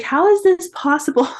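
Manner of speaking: high, stunned disbelief; re-live the shock of the positive test; freaking out. Retold or imitated. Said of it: "freaking out".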